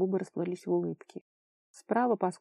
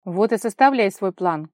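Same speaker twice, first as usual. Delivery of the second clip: loud, indignant outburst; angry shout; 10 dB louder than usual. A narrator's reading. Vara throwing the plan back at Kolya, shouting. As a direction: shout it in his face. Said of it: "крикнула она".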